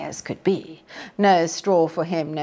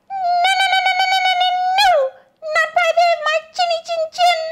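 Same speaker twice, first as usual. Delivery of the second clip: very high squeaky pig, fast; little pig; 6 dB louder, much faster than usual. A narrator's reading. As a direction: squeak out a frantic refusal. Pig refuses.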